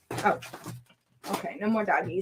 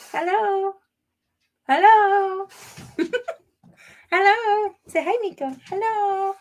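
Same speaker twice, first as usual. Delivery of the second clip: very high, drawn-out hellos; pet talk; 12 semitones higher, 4 dB louder than usual. Real, not acted. Owner shows dog to viewers, high pet talk. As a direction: coo high greetings for the dog.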